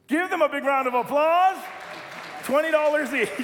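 loud, high call to the congregation; rousing applause call. Real, not acted. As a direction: whip up the crowd's cheering. Applauding loyal youth, rousing crowd.

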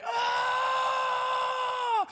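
long, high mock baby scream; high-pitched hammed-up wail; baby's scream. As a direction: imitate a terrified baby's sustained shriek. Mimics dangled baby screaming.

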